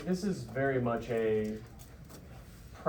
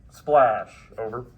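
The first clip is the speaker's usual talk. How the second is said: loud radio call; 12 dB louder, much slower than usual. Shown as a demonstration. FDC radio call in drill.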